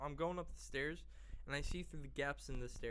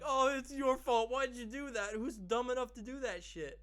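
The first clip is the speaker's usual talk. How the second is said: high, loud retold blaming rant; mock scolding; 9 semitones higher, 7 dB louder than usual. Son blaming his dad in the story, mock scolding. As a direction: scold him in a raised, high-pitched voice.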